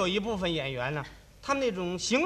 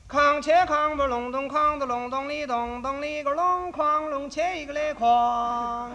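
mouthed gong and drum beats; 5 semitones higher, slower than usual. Pretend. Comedian opening the act by chanting opera percussion. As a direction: chant the Peking opera percussion pattern, raised pitch.

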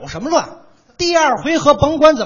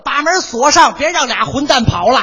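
angry fans; 2 semitones higher, 6 dB louder than usual. Retold or imitated. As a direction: bark it like an outraged fan. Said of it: "怎么说的".

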